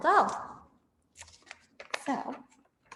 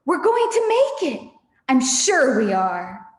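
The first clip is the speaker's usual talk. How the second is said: hopeful Mister Fox; 2 semitones higher, 8 dB louder than usual. Acted. Mister Fox encouraging his family as they dig. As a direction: urge them on with hope. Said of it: "and Mister Fox would say".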